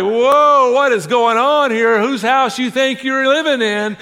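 loud, high mock outrage; indignant parent. Parent reacting to a teenager throwing attitude, acted indignant outburst.

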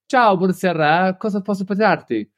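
brisk, high-pitched Italian bartender patter; quick, direct Italian. Retold or imitated. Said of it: "very direct, quick Italian".

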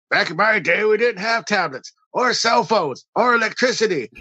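put-on high, cranky old-timer griping; grumpy old man. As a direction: grouse like a crotchety grandpa. Old-timer back in my day.

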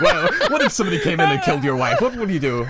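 high, loud laughing mock question; mock interviewer. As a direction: ham up a silly hypothetical. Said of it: "It's like".

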